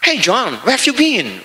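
a light English accent, casual greeting; lightly put-on English accent, friendly call. Shown as a demonstration. Preacher to congregation, showing a native-like English accent. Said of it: "kayak orang Inggris".

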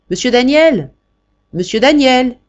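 loud, high call up the stairs; calling out from below. Acted. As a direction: shout his name from downstairs.

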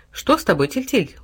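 ordinary delivery

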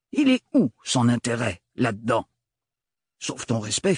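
oncle ronchon, passant d'aigu à grave; un vieux qui rabaisse sa nièce; vieil oncle bourru. A narrator's reading. Le grand-oncle méprisant. (grand-oncle bougon et condescendant)

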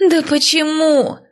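high, drawn-out protest; stretched, high-pitched frustration; exasperated wail. A narrator's reading. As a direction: wail the question at a stubborn sister. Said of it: "взвыла Лестра".

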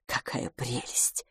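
soft, slow, hushed delight; excited curiosity. A narrator's reading. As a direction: gush under your breath, low and slow. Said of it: "взволнованная и полная любопытства". (тихо, с восторженным любопытством)

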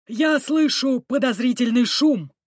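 loudly announcing, a man's voice. A narrator's reading. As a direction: call it out loud. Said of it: "громко сказал".

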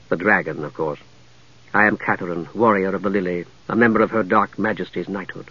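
low, soft, cultured woman warrior; composed lady knight. Acted. Lady knight introducing herself to the mage, composed and low. Narrator voices Lady Catherine.

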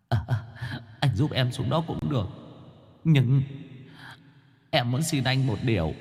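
high, soft, humble ghost asking a favour; pleading ghost. Acted. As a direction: beg meekly, voice high and small. Vong Quân xin một điều.

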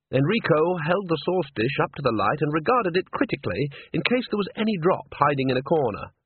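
plain reading voice

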